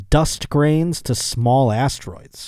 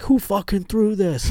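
higher, slow, mock angry yell; 9 semitones higher than usual. Hit by a rock, yelling at the sky in mock rage. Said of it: "I'd be yelling at the sky".

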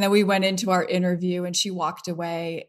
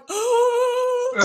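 a sharp, high gasp of disbelief; high shocked gasp; 5 dB louder than usual. Retold or imitated. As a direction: suck in air, totally starstruck. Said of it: "And I'm like".

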